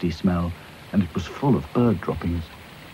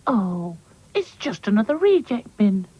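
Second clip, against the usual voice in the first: Lucy's voice; 13 semitones higher than usual. Acted. Lucy disappointed.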